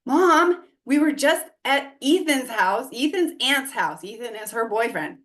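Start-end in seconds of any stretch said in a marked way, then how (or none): 0.0-4.0 excited daughter. Retold or imitated. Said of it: "But her daughter says".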